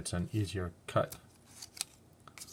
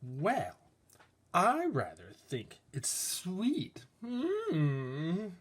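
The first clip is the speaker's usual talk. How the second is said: higher, slow, dainty voice; prim sweet figure; 9 semitones higher, much slower than usual. Acted. Sweet object figure replying primly. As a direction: savour the word sweet.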